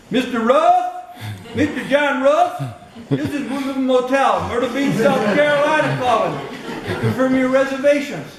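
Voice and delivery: mock southern motel clerk voice, barely holding back a laugh; southern accent. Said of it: "So I try to use a southern ac-, accent".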